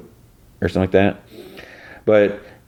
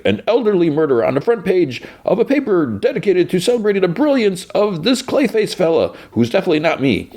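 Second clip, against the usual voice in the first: high, blustering newsroom boss; lofty, much higher mock bluster; pompous fake Perry; 13 semitones higher than usual. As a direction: play the self-important editor. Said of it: "fake Perry's like".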